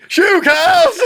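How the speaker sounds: high, loud hammed-up battle yell; mock heroic shout. Acting out Team America to stop cows, mock shout. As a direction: shout it like a film parody. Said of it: "what happened in Team America".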